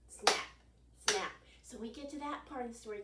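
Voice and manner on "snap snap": crisp snapping 'Snap'; snap sound effect. Shown as a demonstration. To the kids, showing the alligator's snap. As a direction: snap it out like jaws closing. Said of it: "alligators do this".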